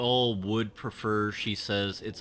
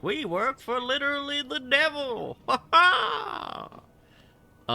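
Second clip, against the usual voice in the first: manic coworker laugh; 4 dB louder than usual. Retold or imitated. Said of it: "it's just like".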